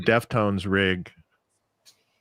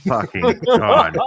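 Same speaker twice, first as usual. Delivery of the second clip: laughing; 11 semitones higher, 6 dB louder, much slower than usual. Real, not acted. Laughing at the dance idea.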